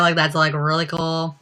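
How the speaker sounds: usual speaking voice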